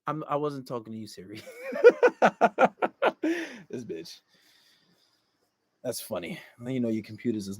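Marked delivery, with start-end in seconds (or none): 1.6-3.5 real laugh. Real, not acted. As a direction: burst of high laughter. Laughs at Siri interrupting.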